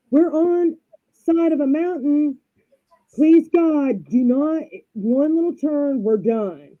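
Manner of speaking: high, pleading husband in mock panic; acted panic. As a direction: beg for your life, retelling it comically. Acts husband panicking on mountain road.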